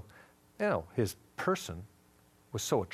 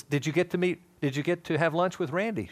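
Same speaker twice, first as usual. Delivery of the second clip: lightly voiced, higher and louder curious friend; eager friend; 5 semitones higher, 4 dB louder than usual. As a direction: ask like a keen mutual friend. Mutual friend asks about the lunch.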